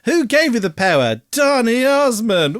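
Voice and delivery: loud mock call and response. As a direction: ham up the call and the kids' reply. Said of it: "doing the call and response with the kids' choir".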